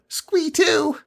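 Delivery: very high squeaky goblin; goblin squeal. A narrator's reading. Squee reports through speaking tube, squealing. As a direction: squeal it eagerly.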